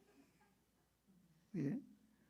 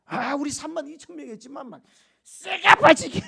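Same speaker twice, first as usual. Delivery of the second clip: lightly voiced proud soldier, louder and higher; boastful soldiers; 7 semitones higher, 9 dB louder than usual. Preacher mocking Israelites boasting of victory, hammed-up. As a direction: brag about the win. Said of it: "이렇게 할까 봐".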